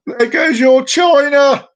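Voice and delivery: slow, high, loud jeer; mock taunting shout. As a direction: call it out long and loud. Joke character calls out triumphantly.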